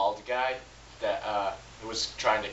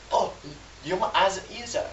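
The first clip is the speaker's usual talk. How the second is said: a hint of British, higher and fast; failed British accent; 7 semitones higher than usual. Reviewer attempts Andrew Garfield's line in a failed British accent. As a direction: try a British accent and miss. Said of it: "That was not British".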